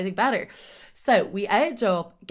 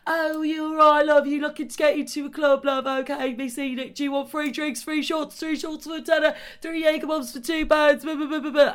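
strip promoter; 8 semitones higher than usual. Retold or imitated. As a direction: pitch the drinks like a pushy club promoter. Street promoters calling out on strip.

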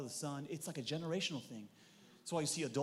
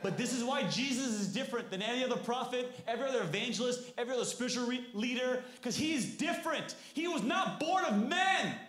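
high, loud, impassioned preaching; preaching at full force; 10 semitones higher, 6 dB louder than usual. Real, not acted.

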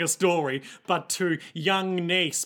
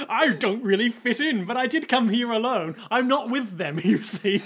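high-pitched prim outsider; odd one out; 6 semitones higher than usual. Acted. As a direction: explain in a high, put-on voice. Playing the misfit of the group.

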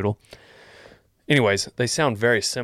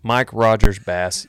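announcer read; 9 dB louder, slower than usual. Shown as a demonstration. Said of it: "announcing the name again".

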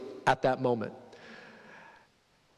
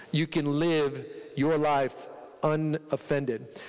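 slow emphasis; much slower than usual. Acted. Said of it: "sermon emphasis".

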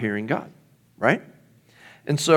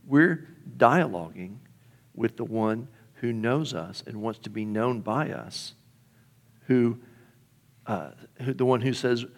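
ordinary talk, nothing marked

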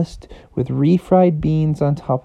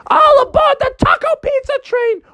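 conductor call; 5 dB louder than usual. Retold or imitated. Mom as conductor calling aboard, shouted high.

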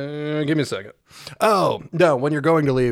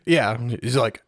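normal talking voice

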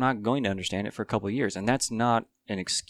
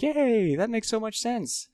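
squeaky high cheer of fake delight; mock delight; 12 semitones higher, slower than usual. Hearing something that fits, a sudden high-pitched cheer. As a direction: chirp it way up like a thrilled kid. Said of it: "you're like".

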